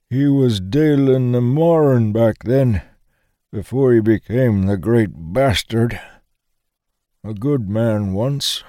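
put-on high, slow, frail old veteran remembering; old man voice. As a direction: voice a frail old soldier lost in memory. Old man speaks of Dalus.

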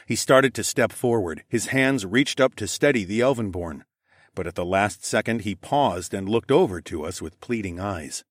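plain reading voice

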